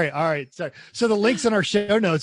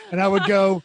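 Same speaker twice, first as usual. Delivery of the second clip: ordinary delivery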